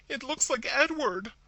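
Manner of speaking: high, loud frantic cry; panicked sister crying out the dead man's name; frenzy of fear. A narrator's reading. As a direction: blurt it out in terror. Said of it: "in a sort of frenzy of fear".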